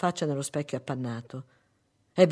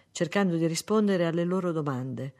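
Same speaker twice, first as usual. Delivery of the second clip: ordinary delivery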